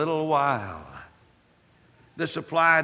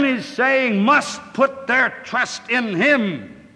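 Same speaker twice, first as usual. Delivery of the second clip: fervent preaching; 3 semitones higher, 6 dB louder than usual. Acted. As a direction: drive the verses home to the listeners. Preaching at full force.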